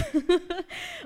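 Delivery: high-pitched giggle; amused laugh. Real, not acted. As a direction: giggle at your own retelling. Laughs at her own retelling.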